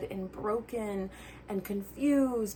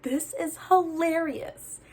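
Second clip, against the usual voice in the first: amused; 8 semitones higher than usual. Retold or imitated. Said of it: "I was like".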